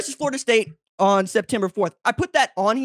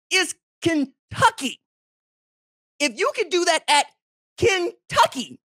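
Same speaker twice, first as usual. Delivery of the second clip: high, stretched-out wail of disbelief; mock disbelief; 9 semitones higher, slower than usual. Real, not acted. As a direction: drag out Kentucky like it's unthinkable. Mock outrage about Kentucky defense.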